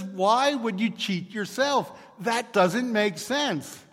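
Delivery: a wife who can't believe her ears, hammed up; incredulous. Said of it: "she's still incredulous".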